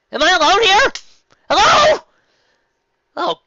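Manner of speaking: ordinary talk, nothing marked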